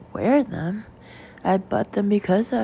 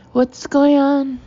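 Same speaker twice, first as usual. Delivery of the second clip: much higher, loud and slow; furious; 7 semitones higher, 8 dB louder, slower than usual. A narrator's reading. Manageress confronting cashiers, furious and loud. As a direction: storm in demanding answers. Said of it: "addressing the cashiers furiously".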